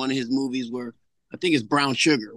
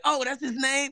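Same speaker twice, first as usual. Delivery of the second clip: higher, dawning realization; daughter realizing; 10 semitones higher than usual. Retold or imitated. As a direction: play the daughter catching on. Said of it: "She's like".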